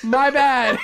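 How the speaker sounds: high laughing apology. Real, not acted. Still laughing.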